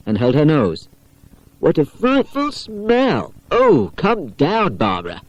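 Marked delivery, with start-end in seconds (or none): 1.6-5.2 slow, higher mock disgust. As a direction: pull a face at the stink. Pam disgusted by smell.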